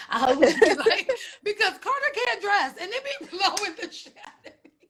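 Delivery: words bubbling with real laughter; laughing while talking. Real, not acted. Teasing a friend about her man's outfits, laughing. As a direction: crack up mid-sentence at the thought.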